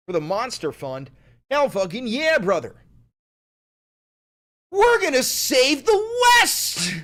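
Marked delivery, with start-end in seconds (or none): 1.5-6.9 much higher and louder, rising to a roar; hype shout. Pretend. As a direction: pump up the crowd.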